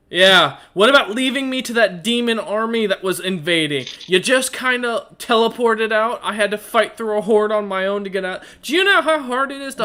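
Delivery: he scolds the friend who teleported away; resentful complaint. Acted. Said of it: "and Czar just kinda goes".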